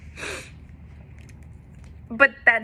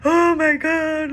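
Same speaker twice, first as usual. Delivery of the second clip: high, slow anguished wail; crying out in release; tearful cry; 9 semitones higher, 7 dB louder, slower than usual. Retold or imitated. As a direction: let it break out, high and drawn out. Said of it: "crying and I was like".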